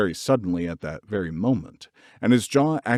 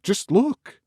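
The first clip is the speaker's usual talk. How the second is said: excited whisper; 11 semitones higher than usual. A narrator's reading. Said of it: "he whispered excitedly".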